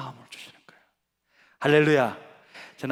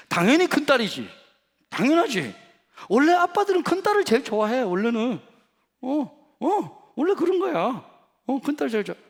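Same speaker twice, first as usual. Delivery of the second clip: much higher, louder eager father; eager reassurance; 10 semitones higher, 6 dB louder than usual. Retold or imitated. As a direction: gush to win her over. Father reassures eldest daughter. (높고 크게 신나서 달래는 아빠)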